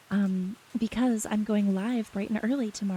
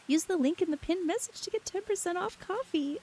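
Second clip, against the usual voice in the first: high, bright sponsor read; 10 semitones higher than usual. Pretend. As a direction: sell it like a cheesy commercial. Reads sponsor plug in put-on voice.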